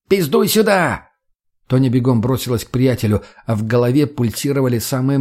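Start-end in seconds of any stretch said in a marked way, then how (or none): 0.1-1.1 high, drawn-out summons; acted impatient call. A narrator's reading. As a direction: holler someone over from a distance. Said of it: "донёсся крик мутного от машины".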